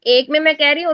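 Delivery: usual speaking voice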